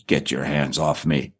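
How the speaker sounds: low, slow menacing growl of a warning; deep, deliberate threat in a retold quote; gruff old actor. Retelling an old actor's angry reply.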